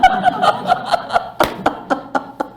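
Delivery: high breathy laugh at her own joke; preacher's laugh. Real, not acted. Preacher laughing at the punchline of her story. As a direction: bursts out laughing in the pulpit. Laugh after husband quote.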